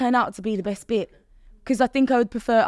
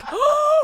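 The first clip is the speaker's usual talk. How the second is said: sharp high gasp of shock; a sudden intake of breath at her own slip; 5 dB louder than usual. Retold or imitated. Instructor realising her funeral slip, gasping sharply. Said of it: "And I was like".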